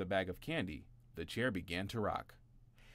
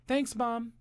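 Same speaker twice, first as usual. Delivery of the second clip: high, loud thanks; said loudly; 8 dB louder than usual. A narrator's reading. Eldest brother thanks mom loudly. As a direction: call out cheerfully. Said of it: "he said loudly".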